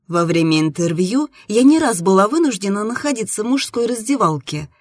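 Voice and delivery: usual speaking voice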